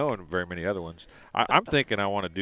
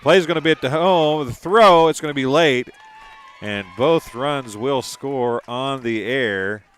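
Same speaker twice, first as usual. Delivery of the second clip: excited call; 3 semitones higher, 6 dB louder than usual. Real, not acted. Live call of runners scoring.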